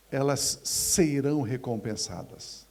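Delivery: ordinary delivery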